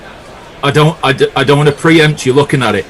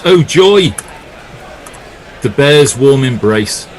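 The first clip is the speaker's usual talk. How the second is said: mock delight; 2 semitones higher, slower than usual. Co-commentator reads listener's message about the Bear, mock delight. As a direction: read it out with mock rapture.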